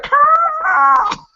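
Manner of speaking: very high, loud scream of the name; screaming Carl. Retold or imitated. Everyone mourning Carl, piercing scream. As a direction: scream the name at full force. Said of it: "they're gonna see Carl like".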